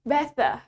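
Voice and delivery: better keeping its T; British pronunciation. Shown as a demonstration. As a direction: say "better" as "bet-tah". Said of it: "Tetap T".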